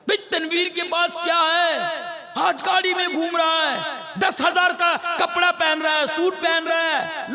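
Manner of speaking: shouted, scornful imitation of a jealous man; mocking gossip. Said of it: "लोगों के सामने कहता".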